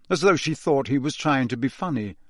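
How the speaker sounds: plain reading voice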